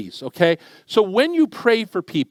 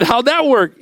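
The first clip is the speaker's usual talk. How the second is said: amused disbelief; 6 semitones higher, 5 dB louder than usual. Retold or imitated. Incredulous teasing question to Matt.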